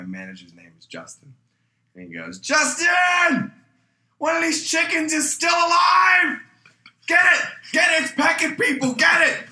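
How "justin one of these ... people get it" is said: high, full-blown panicked scream; maniacal screaming. Retold or imitated. Cook screaming at his manager about the chickens, maniacal shouting. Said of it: "this maniacal motherfucker".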